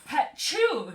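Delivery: loud, high hammed-up sneeze; playful mock sneeze. Girl sneezing while drawing, mock sneeze. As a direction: belt out a cartoon sneeze. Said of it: "she sneezed".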